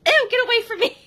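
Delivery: mock squealing rejection of a hug; disgusted brush-off. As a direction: shove him away with your voice. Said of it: "and she's like".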